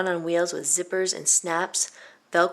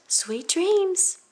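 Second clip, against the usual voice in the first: much higher mother's goodnight; 14 semitones higher than usual. A narrator's reading. Mother tucking Violet in, high goodnight. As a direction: say goodnight sweetly like a mom. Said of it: "her mother said".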